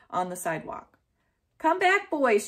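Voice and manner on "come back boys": high, loud call after the dogs; crying out. A narrator's reading. Neighbor calling her dogs, loud high cry. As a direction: call the runaway dogs back loudly. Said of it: "she cried".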